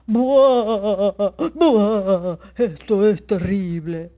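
loud wailing sobs in a big bear voice; the grumpy bear bawls out his sorrow; crying bear. Acted. The old bear laments to the forest, loud blubbering sobs. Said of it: "el oso empezó a llorar".

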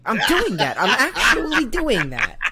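high, loud protest through laughter; laughing protest. Retold or imitated. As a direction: protest while cracking up. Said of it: "I'm like".

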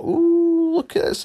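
high breathy gushing coo; mock gushing. Mocking partygoers gushing at the confetti, high coo. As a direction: coo it with fake wonder. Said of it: "these are all like".

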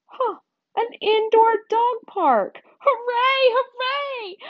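high-pitched delighted cheering; overjoyed squeal of discovery; excited dog. Acted. Dog thrilled by dog park.

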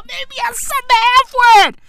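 high, rushed squeal through laughter; laughing. Real, not acted. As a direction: squeal it out while cracking up. Same line again, cracking up.

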